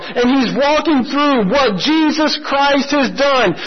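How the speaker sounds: each He struck hard, building; rising preaching. Acted. Preacher listing what Christ has done, building with fervor. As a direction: build the list with fervor, he after he.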